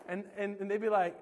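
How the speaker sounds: ordinary talk, nothing marked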